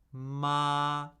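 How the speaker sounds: "ma" held high, flat and unchanging; steady, level "ma"; first tone. Shown as a demonstration. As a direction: hold "ma" on one level high note. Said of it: "high, flat".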